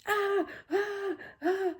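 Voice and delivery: high playful scream noises; mock child screaming. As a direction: squeal like a toddler running in the dark. Imitating grandson screaming in tunnel.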